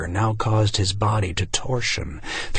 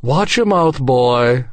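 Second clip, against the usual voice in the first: loud, raised-pitch warning; angry father; 4 semitones higher, 7 dB louder, slower than usual. A narrator's reading. Father warning the boy, loud and angry. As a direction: scold sharply at full voice. Father scolds his son.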